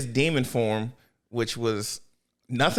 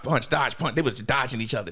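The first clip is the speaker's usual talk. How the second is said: ordinary talk, nothing marked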